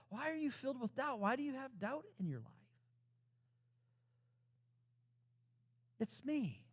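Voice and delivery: reassuring Jesus. Acted. Jesus calming frightened disciples.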